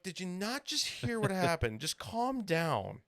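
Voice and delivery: high, incredulous scolding; exasperated retort. Retold or imitated. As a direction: plead for sense, dragging out the last words. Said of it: "And I'm like".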